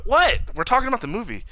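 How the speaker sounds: high-pitched, incredulous objection; indignant protest. Real, not acted. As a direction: push back, voice leaping up on the first word. Protesting at co-host's scolding.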